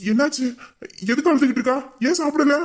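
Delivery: high, rising worried questions; anxious questioning. Acted. Brother anxiously grills the caretaker about sister, high and worried.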